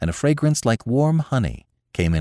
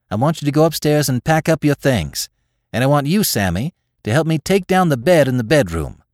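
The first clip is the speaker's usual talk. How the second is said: higher, decisive motherly command; brisk-minded mother taking charge; firm orders; 8 semitones higher, 4 dB louder than usual. A narrator's reading. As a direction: hand out chores with resolve. Mother giving orders to children.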